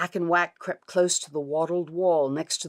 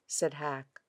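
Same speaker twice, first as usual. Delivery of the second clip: ordinary delivery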